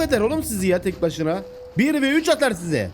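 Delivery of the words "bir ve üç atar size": high, drawn-out are-you-crazy; mock outrage. Real, not acted. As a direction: scoff at the wolves. Mocking the wolves.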